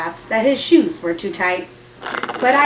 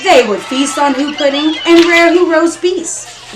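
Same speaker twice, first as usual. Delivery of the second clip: gusto; 7 semitones higher, 7 dB louder than usual. A narrator's reading. Feast described with gusto.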